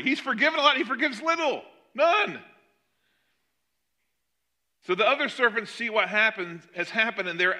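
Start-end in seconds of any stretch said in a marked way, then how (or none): 0.0-2.4 high, emphatic punch on none; impassioned climax. Real, not acted. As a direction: drive the contrast home. Preacher climax on contrast.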